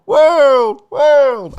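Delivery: high, loud voice imitating an echo; homemade echo effect. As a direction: repeat it like an arena echo, dying slightly. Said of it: "I just need a sound effect for that".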